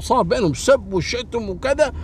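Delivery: ordinary talk, nothing marked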